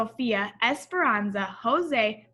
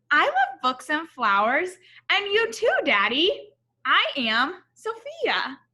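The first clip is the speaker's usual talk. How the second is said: put-on higher, louder little girl voice, lightly done; a small girl proudly imitating grandma; child voice; 5 semitones higher, 6 dB louder than usual. [name] tells her daddy she is like Sophia, proud little girl.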